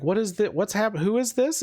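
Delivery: high-pitched, broken-off startled questions; bewildered guards. Acted. Other guards at the gate seeing the stranger, bewildered. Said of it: "look over and are like".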